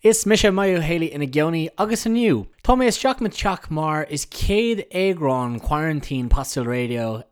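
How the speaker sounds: upbeat Irish-language show opener; bright announcer welcome; radio host intro. Acted.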